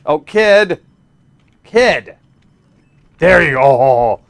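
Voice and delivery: high loud calling; urgent cheering; man down shout. Real, not acted. Urging kid in game.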